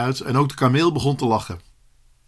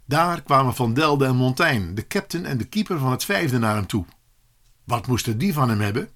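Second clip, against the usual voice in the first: plain reading voice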